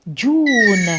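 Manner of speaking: جون stretched slowly, "Juuune" with English j; example word. Shown as a demonstration. Teacher gives students an example, slow pronunciation demo. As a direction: draw out the vowel of جون. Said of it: "كيما".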